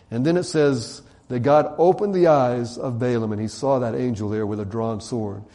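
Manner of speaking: ordinary talk, nothing marked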